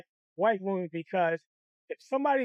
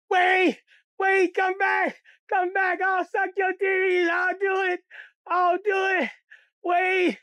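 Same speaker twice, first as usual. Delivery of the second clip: high, loud, tearful pleading; desperate begging; 10 semitones higher, 5 dB louder, slower than usual. Retold or imitated. Kid begging the candy lady to come back, crying shout. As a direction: call after the car, crying.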